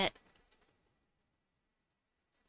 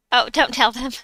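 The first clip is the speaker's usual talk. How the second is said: louder playful protest; 4 semitones higher, 9 dB louder than usual. Real, not acted. Repeats the plea, louder.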